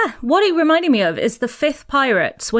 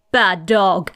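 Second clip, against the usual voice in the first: deadpan low quip; 7 semitones lower than usual. Retold or imitated. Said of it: "looks at her Uncle Dog and goes".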